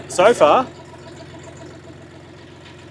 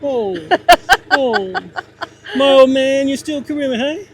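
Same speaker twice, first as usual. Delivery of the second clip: silly clown; 8 semitones higher, 3 dB louder, slower than usual. Pretend. Playfully talking to the tractor.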